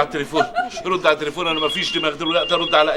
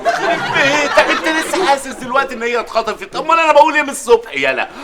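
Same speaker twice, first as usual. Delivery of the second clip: higher, angrier scolding; exasperated rant; 7 semitones higher than usual. Acted. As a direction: scold him for finally catching on. Father exasperated at friend.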